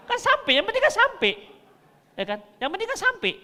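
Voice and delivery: preacher hamming up a loud voice; mock loud emphasis. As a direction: belt it out in jest. Mocking loud voice makes its point.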